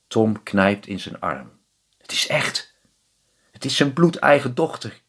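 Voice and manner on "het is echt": soft disbelieving whisper; awed whisper. Acted. Tom seeing his daughter return, whispered in awe. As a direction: hush it in wonder. Father can't believe his eyes.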